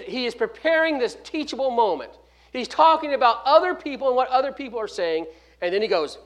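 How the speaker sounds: usual speaking voice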